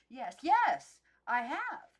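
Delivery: bursting out, loud and high; eager. Acted. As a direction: blurt it out, desperate to be picked. Maggie eagerly interrupts.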